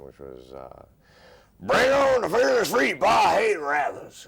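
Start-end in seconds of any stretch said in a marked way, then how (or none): 1.6-4.3 high, booming cartoon Southern yell; Yosemite Sam. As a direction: holler it slowly at full volume, like a blustering cartoon cowboy. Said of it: "cartoon character Yosemite Sam".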